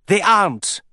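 high, tearful outburst; tearful cry. A narrator's reading. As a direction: cry it out in tears. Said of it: "the princess cried, all tears".

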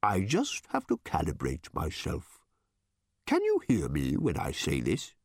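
low, odd bird voice; calibrating bird creature. A narrator's reading. Bird testing whether Random hears it, low odd voice.

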